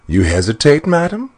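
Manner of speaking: rising, scornful question; mocking challenge. Dwarf taunting the frightened queen, scornful. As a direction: needle her for hesitating. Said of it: "cried the dwarf".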